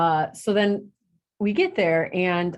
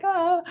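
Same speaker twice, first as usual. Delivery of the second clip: excited squeal; 13 semitones higher than usual. Retold or imitated. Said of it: "And I'm like".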